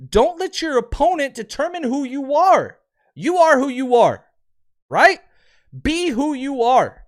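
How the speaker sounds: high, loud and insistent; impassioned exhortation. Real, not acted. Host urging the team to stay who they are, impassioned. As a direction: drive it home like a pep talk.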